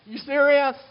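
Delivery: mock astonished protest; incredulous surprise. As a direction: balk at God's command in disbelief. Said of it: "I'm like".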